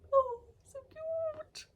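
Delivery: very high, slow squeal; squealing cute voice. Real, not acted. Gushing over toy.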